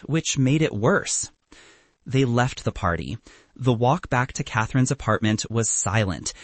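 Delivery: usual speaking voice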